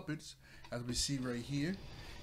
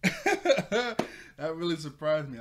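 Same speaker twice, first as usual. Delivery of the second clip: much higher and louder, through a laugh; 3 semitones higher, 8 dB louder, slower than usual. Real, not acted. The reviewer telling viewers about the loud TV, laughing. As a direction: still cracking up at the scare. Laughing after the volume surprise.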